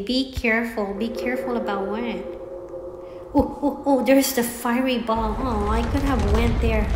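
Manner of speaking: ordinary talk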